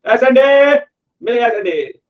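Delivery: high, loud, stretched-out yell; shouting from afar. Acted. Auditor who slipped calling the management from afar, high drawn-out shout. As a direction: holler it to someone far away, stretching each ending. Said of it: "वो दूर चिल्लाएगा management को".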